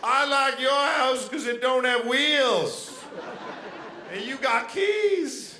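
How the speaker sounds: slow, high, loud Mississippi drawl; Southern girl accent. Retold or imitated. Mississippi girl saying adorable things to her boyfriend, thick Southern drawl. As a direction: imitate a Mississippi girl, stretching every word. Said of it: "This girl was from Mississippi".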